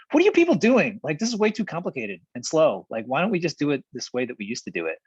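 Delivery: Rob barging in fed up with the complexity; exasperated Rob. Retold or imitated. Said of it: "Rob is always the one that comes in and is like".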